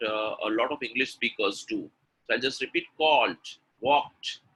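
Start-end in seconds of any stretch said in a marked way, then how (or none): 3.0-4.4 "called" and "walked" with hard released endings; sounded word endings. Shown as a demonstration. Teacher drilling word endings for repetition, "called, walked" finished crisply. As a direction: pop the last consonant of "called" and "walked". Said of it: "you need to make the sound".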